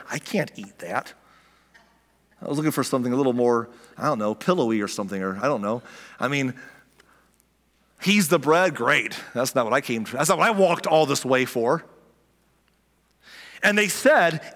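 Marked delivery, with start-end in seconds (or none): 0.1-11.8 low, disappointed grumbling voice, a hint of mockery; lightly sent-up complainer who wanted fluffier bread; grumbling crowd.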